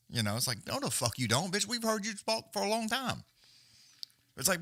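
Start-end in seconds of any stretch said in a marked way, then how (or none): 0.7-3.2 higher-pitched scoffing comeback; exasperated contradiction, pitch jumping up; indignant retort. Retold or imitated. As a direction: call out her lie, voice climbing high. Imagined retort to her claim.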